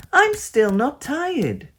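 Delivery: high, loud, slow sulky protest; stubborn little girl, slow and loud; frowning child. A narrator's reading. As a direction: stamp your foot and drag it out. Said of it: "she said with a frown".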